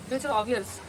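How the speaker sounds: usual speaking voice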